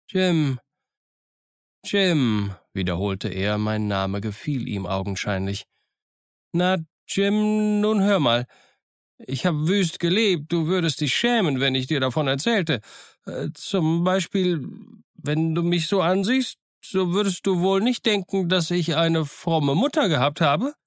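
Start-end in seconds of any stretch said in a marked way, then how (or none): 0.1-2.5 a hermit savouring a name, pitched high; lightly voiced castaway repeating fondly. 6.5-20.7 put-on high, rambling old hermit confessing; lonely castaway pouring out his life.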